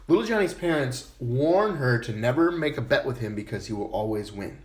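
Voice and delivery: usual speaking voice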